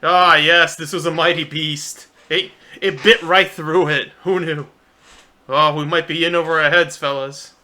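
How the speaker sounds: booming, theatrical hero in awe; hearty adventurer voice. Acted. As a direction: proclaim it like a bold adventurer. Character marvels at a mighty beast.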